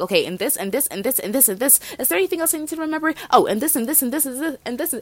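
hurried, breathless-paced run of items piling up; talking fast. Acted. Catching up with best friend on the phone, rapid-fire. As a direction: rattle off a rushed catch-up list. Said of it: "I know I'm talking fast".

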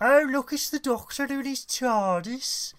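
loud, high mock Brummie; Birmingham accent. Host mimicking a Brummie in Doctor Who, Birmingham accent. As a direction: exclaim in a squeaked-up comic Brummie. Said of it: "No Birmingham person should be in any sci-fi".

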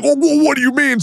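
very high, sputtering mock confusion; flustered mimicry. Streamer mimicking the critic backpedalling, flustered mimicry. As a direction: mimic him stammering in a squeaky pitch.

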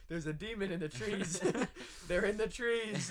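laughing while telling Ryan about the demon. Retold or imitated. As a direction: giggle out the scary news. Laughing retelling of scare.

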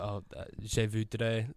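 put-on quiet, slow French in an English accent; hesitant French with heavy English accent. Ordering food in France, halting English-accented French. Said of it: "I do it in such an English accent".

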